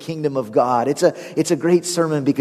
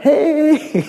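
very high, drawn-out hello; high-pitched greeting; 12 semitones higher than usual. Acted. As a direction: echo an overexcited greeting in mock falsetto. He mimics his greeting back.

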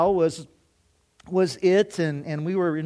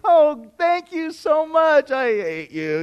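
slow, loud, higher gushing thanks, then a flat dry I hate you; fake-sweet sarcasm; 9 semitones higher, 7 dB louder, slower than usual. Man to his rival visiting late at night, mock-grateful then blunt. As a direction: gush falsely, then drop the mask. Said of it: "I'm like".

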